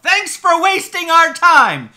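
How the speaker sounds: loud, high, hammed-up indignant yell; theatrical outraged holler; mock shout. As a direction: shout it like a fed-up customer, overacted. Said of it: "fighting the urge to shout".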